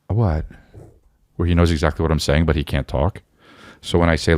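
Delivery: usual speaking voice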